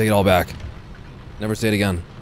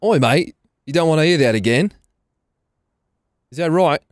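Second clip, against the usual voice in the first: put-on Brit-Australian accent; 4 semitones higher than usual. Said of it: "my Brit-Australian Zealand accent".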